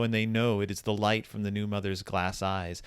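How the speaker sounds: ordinary talk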